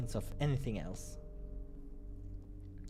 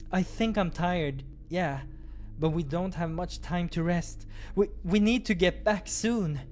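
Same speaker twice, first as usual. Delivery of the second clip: higher, louder anxious insistence; higher eager protest; 8 semitones higher, 5 dB louder than usual. Acted. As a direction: push back, worried and eager. Krille protests being tired.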